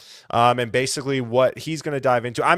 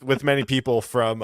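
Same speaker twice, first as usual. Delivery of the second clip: ordinary delivery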